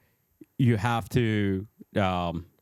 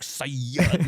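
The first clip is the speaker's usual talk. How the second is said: "Sayyid" stretched out and pitched higher; mock pride, leaning into "Sayyid"; heavy emphasis on Sayyid; 4 semitones higher than usual. Podcaster parodying how Sayyids announce themselves, "Sayyid" drawn out with heavy emphasis. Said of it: "putting so much emphasis on Sayyid".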